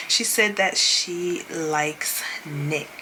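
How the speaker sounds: very slow, word by word; savouring emphasis. Retold or imitated. Host relays Olandria's confession about Nick, savouring it slowly. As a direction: stretch it out for effect.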